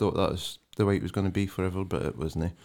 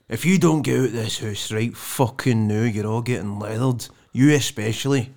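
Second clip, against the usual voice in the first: proper hard-man threat; 6 dB louder than usual. Acted. Housemate showing how to scare off guests, hard threat. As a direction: lay down the law at louder volume, mock-threatening. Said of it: "pull out the fucking proper".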